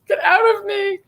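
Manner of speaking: high teary cry-shout; tearful mock wail. Real, not acted. Vlogger to a fan who moved her, teary mock wail. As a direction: wail like she got you crying. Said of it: "I'm not crying. You're crying".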